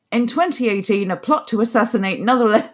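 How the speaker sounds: ordinary talk, nothing marked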